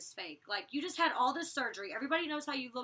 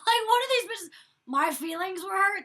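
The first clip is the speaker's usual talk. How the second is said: high, loud scoffing outburst; mock disgusted disbelief; 8 semitones higher, 10 dB louder than usual. Housemate reacting to the girls' hurt feelings, loud mock disgust. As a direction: throw it back in exaggerated, incredulous disgust. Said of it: "like, in disgust".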